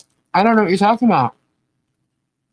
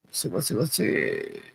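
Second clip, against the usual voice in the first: «let's see» under the breath, much softer, lower; quiet muttering to self; 7 semitones lower, 10 dB quieter than usual. Real, not acted. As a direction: mumble it to yourself while you scroll. Scrolling, muttering to herself.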